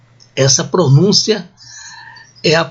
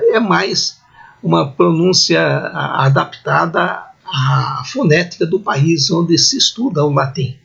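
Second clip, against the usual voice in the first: normal talking voice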